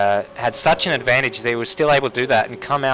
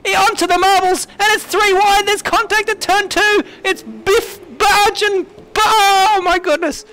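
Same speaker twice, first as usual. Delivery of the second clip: rising, louder play-by-play frenzy; excited race call; 4 dB louder than usual. Real, not acted. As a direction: build the call to a peak. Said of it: "the best commentary call I've ever heard you make".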